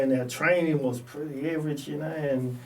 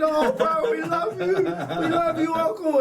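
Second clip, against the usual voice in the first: much higher, half-laughing sob; tearful mock crying; 14 semitones higher, 3 dB louder than usual. Players tearfully thanking their coach, laughing-crying. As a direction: cry it out like grateful players. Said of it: "we started all crying".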